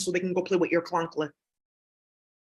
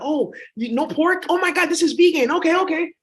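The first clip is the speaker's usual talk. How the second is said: happy auntie; 6 semitones higher than usual. Retold or imitated. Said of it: "She's just happy that".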